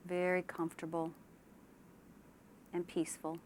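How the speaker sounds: unhurried relaxation-exercise delivery; guided meditation. Shown as a demonstration. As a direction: ease them gently into calm, leaving space after each phrase. Leads a guided imagery relaxation.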